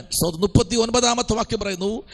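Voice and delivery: ordinary talk, nothing marked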